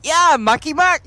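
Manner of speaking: high, loud mock cheer; exuberant exclamation. Joking about her own expression, exuberant shout. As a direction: belt it out before cracking up.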